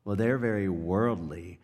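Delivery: lightly condescending imitation; a touch of condescension. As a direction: imitate a mildly disapproving relative. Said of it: "a condescending way sometimes".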